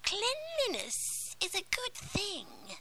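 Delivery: high, whispery menacing witch; a crooning sea witch, sly and cruel. Acted. Witch while scouring cauldron.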